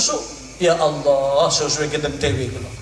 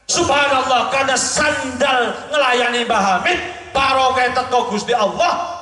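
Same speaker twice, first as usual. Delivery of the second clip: fiery preaching; 8 semitones higher than usual. Acted. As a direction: preach it out at full force. Preaching at full force.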